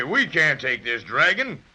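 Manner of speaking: ordinary talk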